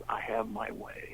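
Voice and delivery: soft hushed slow whisper; a mysterious slowed whisper of a secret. Retold or imitated. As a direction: slip the words out in a secretive whisper. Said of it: "He goes".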